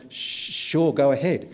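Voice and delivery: imitating a tongue-tied, awestruck fan; starstruck stammer. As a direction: stumble nervously on the first word. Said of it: "all that could sort of come out of my mouth was".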